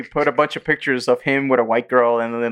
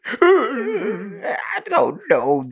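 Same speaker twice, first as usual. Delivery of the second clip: much higher, very slow fake sob; a son answering his father in a higher, drawn-out fake cry; mock crying son; 14 semitones higher, much slower than usual. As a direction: whimper the answer like a hurt son. Son answers in Omni-Man parody.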